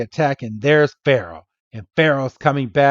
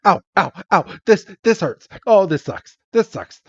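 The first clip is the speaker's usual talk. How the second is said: higher mock whimpering ows; playful higher pained whining; whiny James; 6 semitones higher than usual. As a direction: moan each ow like a beaten cartoon villain. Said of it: "rocking his head back and forth going".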